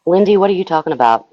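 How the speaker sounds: loud, fast alarmed question. Real, not acted. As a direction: cut in, startled. Alarmed interruption.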